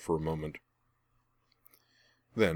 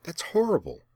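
horrified exclamation; 11 semitones higher than usual. A narrator's reading. Hrian reacting in shock to Laura's news. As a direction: gasp it out in horror. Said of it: "Hrian exclaimed".

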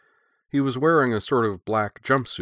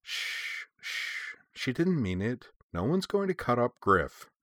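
soft, hushing reassurance; gentle, higher-pitched consoling voice; soothing; 4 semitones higher, 5 dB quieter, slower than usual. A narrator's reading. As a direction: hush and comfort someone distraught. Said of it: "gather her up in a hug".

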